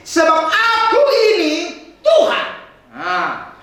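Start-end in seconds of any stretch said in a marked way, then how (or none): 0.1-2.5 preacher to congregation, shouting God's words with a laugh; climactic shout. Acted. Preacher to congregation, booming God's words with a laugh.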